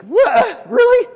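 shocked high "What? Really?"; incredulous, voice jumping up; disbelief. Retold or imitated. Disciples hearing the news, shocked and loud.

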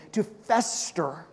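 ordinary delivery

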